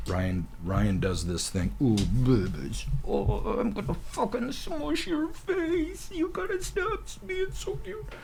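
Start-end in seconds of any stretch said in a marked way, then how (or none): 1.8-8.1 very high, slow cooing voice; baby talk. Retold or imitated. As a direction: coo at babies you want to squeeze. Gushing over cute babies.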